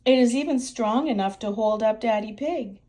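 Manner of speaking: plain reading voice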